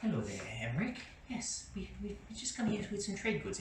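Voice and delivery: a nervous little trader greeting the guard politely; meek trader. Acted. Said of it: "And Skooma Joe's like".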